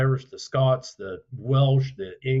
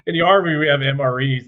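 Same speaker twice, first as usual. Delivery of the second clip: normal talking voice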